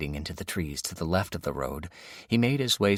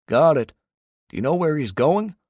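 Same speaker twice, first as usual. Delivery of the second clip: Dave on the radio; 9 semitones higher, 7 dB louder than usual. A narrator's reading. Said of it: "Dave said".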